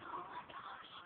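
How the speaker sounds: breathy whispered gasp through tears; tearful whisper. Retold or imitated. Teen retelling mom's text reply, whispering through tears. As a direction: whisper it, overwhelmed and about to cry. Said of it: "I was bawling out in tears".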